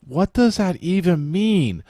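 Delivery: high, slow, baffled voice; baffled exclamation. Retold or imitated. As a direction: sound completely lost and confused. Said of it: "I'm like".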